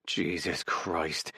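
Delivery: low, slow, quiet growl. A narrator's reading. Weary roommate to the one who won't sleep, growling low. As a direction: growl it under the breath, drawn out. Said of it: "he growls".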